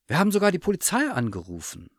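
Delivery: high, rushed outburst; agitated relief. A narrator's reading. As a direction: tumble it out fast and high. Relieved mother after the search.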